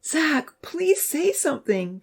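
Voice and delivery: high, pleading cry; crying plea. A narrator's reading. Abby pleads with the unconscious Zach, high and crying. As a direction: beg him to answer. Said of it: "the voice cried".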